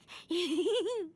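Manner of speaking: a quick pleased little laugh; brief giggle after the joke; short laugh. Real, not acted. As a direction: laugh at your own clever plan. Laughs at own plan.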